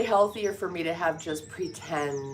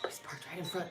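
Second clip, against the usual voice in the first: whisper; 13 dB quieter, faster than usual. Real, not acted. Hushed over parked truck.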